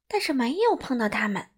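usual speaking voice

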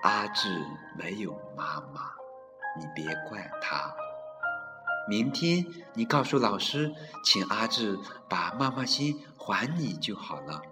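mother comforting her crying son, softly; tender mother. Acted. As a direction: soothe the child gently as you hold him. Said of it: "妈妈搂着他说".

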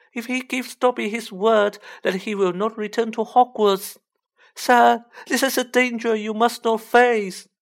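high, urgent pleading elf; a desperate little elf warning of danger; anxious house-elf voice. Acted.

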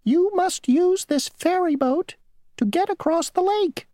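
very high, squeaky insect voice; a tiny, prim rule-keeper; a tiny mosquito insisting on his rule. Acted. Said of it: "said the mosquito".

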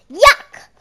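loud high disgusted cry; disgusted yuck. A narrator's reading. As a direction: spit it out in disgust. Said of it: "said Jack".

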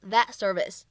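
that service with the t unreleased; incomplete plosion. Shown as a demonstration. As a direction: hold back the t in "that service". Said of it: "不完全爆破".

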